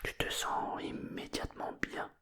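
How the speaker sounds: plain reading voice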